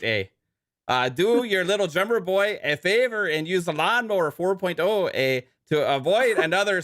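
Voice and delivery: mock Canadian accent reading a sponsor ad. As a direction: sell the razor like a joking Canadian, eh. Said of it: "he's definitely Canadian right now".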